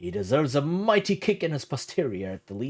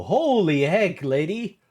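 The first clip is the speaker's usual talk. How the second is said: loud, slow, stunned outburst; holy heck, dragged out loud; shocked exclamation; 2 semitones higher, 7 dB louder, much slower than usual. Real, not acted. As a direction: blurt it out in disbelief. Shocked reaction to the story.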